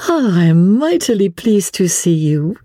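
high, delighted lady's voice; pleased sigh. A narrator's reading. Lady welcomes a friend from Paris, delighted and high. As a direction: let satisfaction lift the voice high. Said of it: "with a pretty little sigh of satisfaction".

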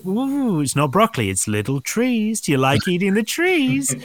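coaxing parent. Retold or imitated.

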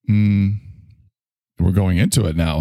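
ordinary delivery

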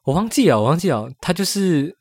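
ordinary delivery

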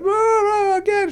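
very high mock crowd cheer. A small theatre crowd begging for an encore, very high mock cheer. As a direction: squeal it like fans begging. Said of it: "the hundred people that were going".

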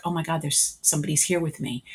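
softly, as if someone might hear; a hint of a startled hush; hushed awe. Sensing a presence in the room.

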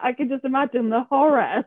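ordinary talk

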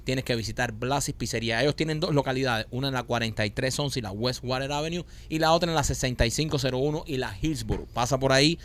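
relaxed radio ad read, a bit soft. Acted. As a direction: pitch the pizzeria like a live radio spot. Host reads pizzeria ad. (lectura de anuncio radial, suave)

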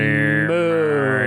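a drawn-out cow moo. Acted. Donor shout-out answered with a cow moo. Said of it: "shout them out like your cows".

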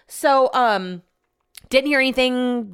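normal talking voice

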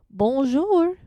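loud, high, drawn-out bonjour; flirty French greeting. Acted. Greeting a French man on a dating app, flirty. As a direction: call it out to catch a Frenchman's eye.

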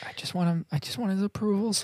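high, soft mock pleading; pleading for approval. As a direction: plead like a needy pupil, high and small. Voices a pupil craving approval.

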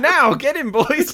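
high gleeful villain order; villain command. Retold or imitated. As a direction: bark it like a gloating villain. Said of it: "voicing Max ordering puppets".

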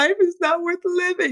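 put-on high, overwrought teen lament; dramatic teen. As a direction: ham up a teenage wail. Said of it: "'cause you're so dramatic at that age".

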